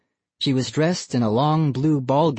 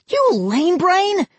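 high, slow furious yell; screaming; 4 dB louder, slower than usual. A narrator's reading. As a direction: scream the insult. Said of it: "Ian screamed".

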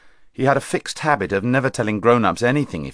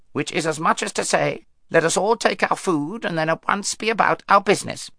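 a lightly voiced raven, higher and unhurried; wise old raven; 8 semitones higher than usual. Raven speaks in story.

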